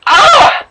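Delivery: sharp high scream of pain; pained cry. Acted. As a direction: cry out as the knife cuts. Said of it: "she cried out as searing pain".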